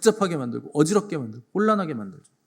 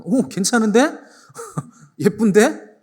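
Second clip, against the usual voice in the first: higher, louder, with a chuckle, slow; leering Pharaoh; 5 semitones higher, 6 dB louder, slower than usual. Acted. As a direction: ham up a smitten king eyeing a woman. Pharaoh ogling Sarah. (파라오가 능글맞게 감탄하듯)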